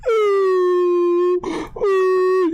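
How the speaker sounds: loud, very high hammed-up wail; mock wailing sob. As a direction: let out a fake howling cry. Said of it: "I was at work crying".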